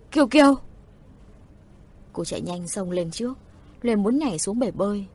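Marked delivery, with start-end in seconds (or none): 0.1-0.5 high, loud shout of a name; urgent, raised call to a drowning child; alarmed cry. A narrator's reading. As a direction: cry out in sudden panic. Sister cries out seeing child drown.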